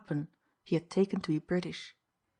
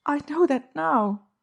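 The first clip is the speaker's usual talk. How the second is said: higher, louder and slow; regretful; 9 semitones higher, 6 dB louder, slower than usual. A narrator's reading. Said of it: "she returned".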